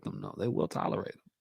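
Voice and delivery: drops to a breathy whisper on the aside; whispered aside to the viewers. Real, not acted. Whispering an aside to viewers about women.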